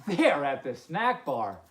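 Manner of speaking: usual speaking voice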